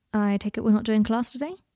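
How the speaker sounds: ordinary delivery, nothing marked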